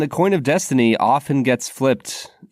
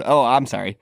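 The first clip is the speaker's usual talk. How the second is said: usual speaking voice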